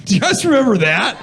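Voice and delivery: loud, high shout of disbelief to the crowd; excited shout. Real, not acted. To the crowd about the show, a loud high shout. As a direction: yell it up at the room, high.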